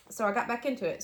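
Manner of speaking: normal talking voice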